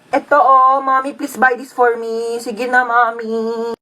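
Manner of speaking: put-on lightly whiny, drawn-out child's begging; pleading child. Child begging Mommy to buy a toy, pleading whine. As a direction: plead sweetly, stretching the endings.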